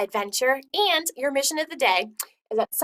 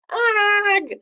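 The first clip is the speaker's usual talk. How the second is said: frustrated groan; 10 semitones higher, 8 dB louder than usual. Acted. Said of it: "Raphael cried".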